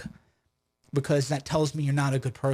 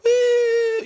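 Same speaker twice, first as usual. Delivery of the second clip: mock cheering woo; 6 dB louder than usual. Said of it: "And they're like".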